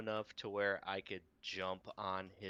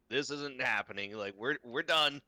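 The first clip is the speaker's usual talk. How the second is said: louder, higher mock battle cry; hammed-up declaration of doom; mock defiant yell; 5 semitones higher, 8 dB louder than usual. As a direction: player acting out a last stand, loud. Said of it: "just be like".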